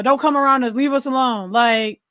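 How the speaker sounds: usual speaking voice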